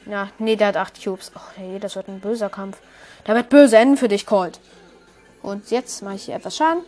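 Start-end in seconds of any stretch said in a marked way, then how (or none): 1.3-2.8 hushed, breathy aside; whisper. Real, not acted. Whispered before a fight. 3.2-4.6 loud mock threat to a rival; taunting threat. Real, not acted. Taunting the opponent.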